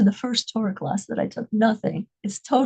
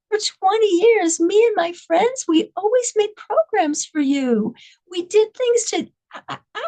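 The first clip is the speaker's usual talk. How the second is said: higher, pleading voice asking why; pleading hostage; 7 semitones higher than usual. Retold or imitated. Hostage pleading with her jailer, higher and pleading. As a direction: plead with him, hurt and bewildered.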